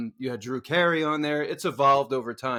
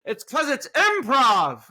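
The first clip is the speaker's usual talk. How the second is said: slow emphatic retort; 9 semitones higher, 5 dB louder, slower than usual. Retold or imitated. Retorting to leaving woman.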